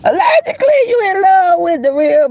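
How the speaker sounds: very high squeal of "Allegedly". Acted. Trolling the next channel for her viewers, high squeal. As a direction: shriek it teasingly.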